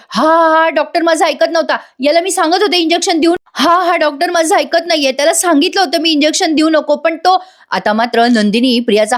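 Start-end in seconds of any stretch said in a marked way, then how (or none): 0.1-7.4 higher, put-on wheedling excuse voice; fake whining. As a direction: play the scheming liar crying foul. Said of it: "Priya लगेच कांगावं करायला लागते". (उंच आवाजात खोटा कांगावा)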